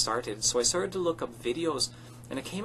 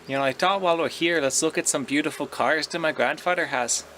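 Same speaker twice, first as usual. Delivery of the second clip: put-on loud, showy Irish accent; 3 semitones higher, 3 dB louder than usual. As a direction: show off the cars like a proud Dubliner. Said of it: "speak with an Irish accent".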